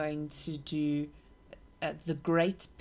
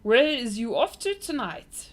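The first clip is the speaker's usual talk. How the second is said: giant shouting; 7 semitones higher, 9 dB louder than usual. A narrator's reading. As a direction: call out loud and higher over galloping feet. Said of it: "shouted the B F G".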